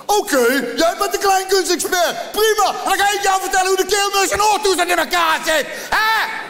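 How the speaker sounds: high shouted sarcastic tirade; furious rant. Retold or imitated. Comedian mocks the doctor's expertise, high furious shout. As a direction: bellow it back at him mockingly. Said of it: "Ik zeg".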